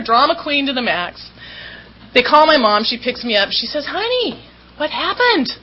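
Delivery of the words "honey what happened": mom's voice. Retold or imitated. Mom voiced picking her up.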